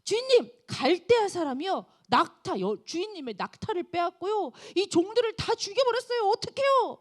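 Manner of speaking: high, distressed report; third servant. Acted. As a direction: plead helplessly at the end. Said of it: "다른 사환이 들어옵니다". (높고 애타는 종의 목소리)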